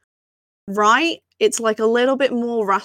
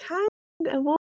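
a much higher rising how, what, what; mock baffled; 9 semitones higher, slower than usual. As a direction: play at being totally confused by the accent. Mimicking confusion at accent.

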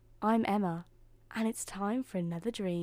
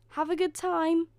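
high, louder friendly call; 9 semitones higher, 5 dB louder than usual. A narrator's reading. Cinderella to departing stepfamily, calling out. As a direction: call it after someone leaving. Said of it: "called Cinderella".

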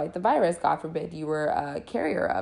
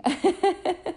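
quick high giggle; short laugh; 11 semitones higher than usual. Real, not acted. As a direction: let a little laugh slip out. Laughs at own yawn.

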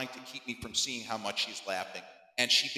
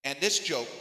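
ordinary delivery, nothing marked

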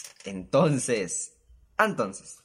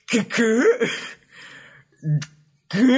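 high, loud shocked disbelief; shocked qué; 9 semitones higher, 8 dB louder, much slower than usual. Retold or imitated. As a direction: yelp out the disbelief. Shocked reaction to news.

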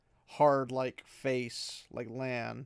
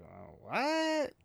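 confused; 11 semitones higher than usual. Retold or imitated. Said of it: "And he's like".

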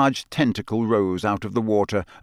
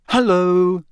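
Hermione voice; 10 semitones higher, 6 dB louder than usual. A narrator's reading. Hermione greets Harry.

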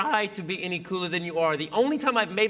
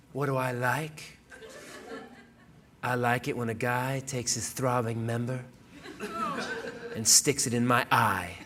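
mock seductive; 7 semitones lower, 4 dB quieter than usual. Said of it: "she backs away and goes".

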